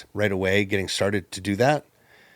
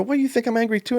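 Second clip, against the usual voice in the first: mock startled high question; 14 semitones higher, faster than usual.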